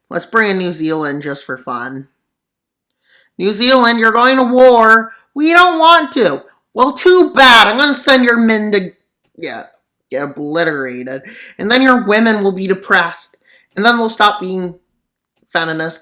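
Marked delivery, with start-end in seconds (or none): 3.4-6.4 higher, louder squeaky country voice; mock country voices. Voicing countries arguing over war. 6.7-16.0 higher, louder bossy voice; bully the small nation loudly; mock bossy nation. Voicing the bossy ally.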